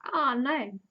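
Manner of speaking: hammed-up groan of disappointment; mock dismay. Fan reacting to the missed hug, mock dismay.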